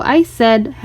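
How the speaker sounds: plain reading voice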